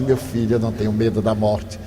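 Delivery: soft and low, unhurried and serene; very calm. Retold or imitated. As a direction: say it gently to the robber, without fear. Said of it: "me olhou muito calmo". (calmo e sereno, baixinho)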